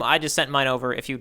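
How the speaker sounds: normal talking voice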